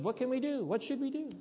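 high, urgent questioning crowd; anxious pleading crowd. Retold or imitated. Crowd at Pentecost asking Peter.

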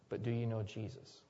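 soft repeat. Real, not acted. Preacher repeats the question to the congregation, hushed. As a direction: drop the voice low for the question.

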